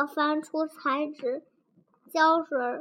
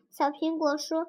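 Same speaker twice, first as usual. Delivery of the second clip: plain reading voice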